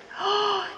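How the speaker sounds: quick shocked intake of breath; a sudden storyteller's gasp; sharp high gasp. Acted. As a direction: gasp as if startled.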